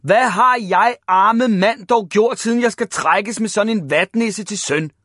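high, loud exasperated wail of complaint; shouted lament.

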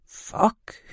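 soft, voiceless expletive; hushed curse. A narrator's reading. Bystander finding the injured woman, swearing in a hushed whisper. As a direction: whisper it under the breath. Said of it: "hørte hun viborgenserstemmen sige".

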